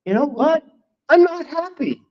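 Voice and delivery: blurted confession. Retold or imitated. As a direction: let it burst out to his friend. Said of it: "So I told him, I said".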